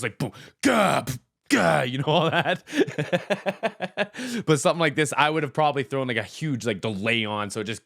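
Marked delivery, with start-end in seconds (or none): 0.0-1.8 drum imitation. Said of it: "You'd just be getting this". 2.7-4.4 bursting into a high giggle; high laugh. Real, not acted. Vlogger laughs at his own drum noises, high giggle.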